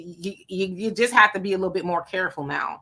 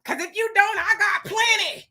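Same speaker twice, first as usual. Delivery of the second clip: heated taunt; 10 semitones higher than usual. Real, not acted. Streamer taunts someone about having a good rope, heated high-pitched challenge.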